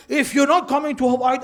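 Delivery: hammed-up scornful dismissal; mocking harsh version. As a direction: parody the harsh retelling, hammed-up. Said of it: "is that your Hussein?".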